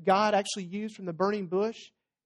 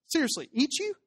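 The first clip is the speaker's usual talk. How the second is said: acted incredulity; 6 semitones higher than usual. Crowd challenges Jesus in disbelief.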